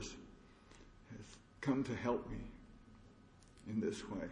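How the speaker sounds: slow hushed words of gratitude; slow hushed reverence. Real, not acted. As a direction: let it fall to a reverent hush.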